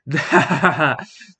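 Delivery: loud mocking oni laugh; a booming, scornful laugh; the oni laughs at the priest; demon laugh. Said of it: "Yoni started laughing again".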